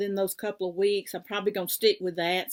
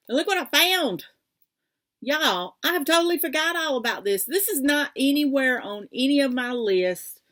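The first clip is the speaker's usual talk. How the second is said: higher, louder, fast and thrilled; excited discovery; 5 semitones higher, 5 dB louder than usual. Real, not acted. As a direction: gush about a forgotten find. Surprise find in the drawer.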